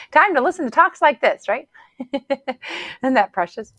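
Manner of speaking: high, loud giggly delight, slowing at the end; laughing. Real, not acted. Coach laughing about listeners hearing her talk. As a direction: bubble over with joy.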